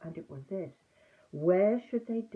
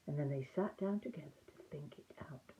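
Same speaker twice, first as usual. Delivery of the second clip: hushed whisper; soft storyteller's whisper; 3 semitones lower, 9 dB quieter than usual. A narrator's reading. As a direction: drop to a secret whisper. Sudden whispered narration.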